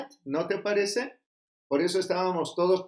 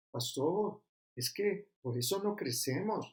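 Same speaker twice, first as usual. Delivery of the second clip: complaining member; 8 dB quieter than usual. Retold or imitated. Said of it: "quoting complaining member".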